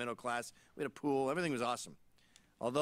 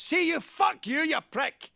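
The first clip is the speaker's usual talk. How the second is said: high, loud screeched threats in Glaswegian; enraged Glaswegian woman; 10 semitones higher, 6 dB louder than usual. Retold or imitated. Glaswegian woman threatening the man who insulted her, shouted imitation. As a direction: rant at full pitch as a furious Glasgow woman. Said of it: "a Glaswegian woman was there".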